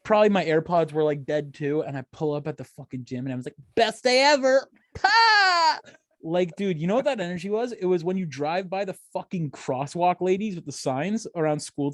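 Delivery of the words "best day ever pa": high, loud, seething mock cheer; hammed-up angry outburst; mad mock cheer. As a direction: shout it high, fury dressed as positivity. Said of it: "just blood red mad".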